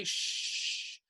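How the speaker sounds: a soft unvoiced hush; soothing shush to a sobbing elf. A narrator's reading. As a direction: hush him urgently but kindly. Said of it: "trying to say".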